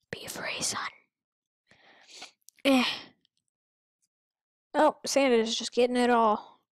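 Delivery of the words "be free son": breathy mock-solemn whisper, very soft. Gamer whispering a mock farewell to a dying character, hushed. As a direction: let the line slip out as a hushed farewell.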